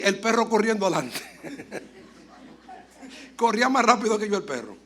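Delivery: chuckling through the words; amused, laughing at himself. Real, not acted. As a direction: laugh as you tell it. Laughing at own story.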